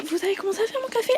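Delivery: high, fast pestering voice; nagging customer impression. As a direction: nag high and quick. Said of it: "elle vient trois fois me demander".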